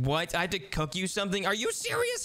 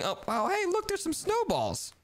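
ordinary talk, nothing marked

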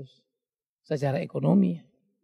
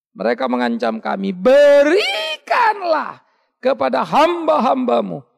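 loud, high, shouted sermon; fiery preacher crying out the psalm; full-force preaching; 6 semitones higher, 8 dB louder than usual. Acted. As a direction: thunder the scripture at the crowd.

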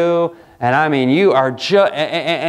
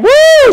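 very high, loud mock cheer; mock stadium whoop; 12 dB louder than usual. Preacher mocks football fans to the congregation with a high, loud whoop.

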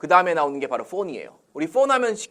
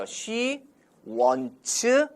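slow, higher, spaced-out English words; slow model reading; 9 semitones higher, much slower than usual. Shown as a demonstration. Teacher models English for students to repeat, slowly word by word. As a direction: say it word by word for learners. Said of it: "따라죠".

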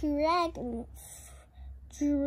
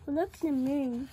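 plain reading voice

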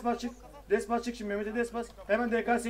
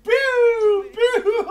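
very high, loud "piu" squeal; imitated shriek; 12 semitones higher, 9 dB louder than usual. Player mocking how loudly a teammate yells, high shrieking imitation. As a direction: screech it, mimicking someone's yell. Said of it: "Cemal nasıl bağırıyor amına koyayım".

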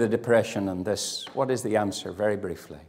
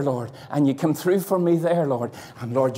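ordinary talk

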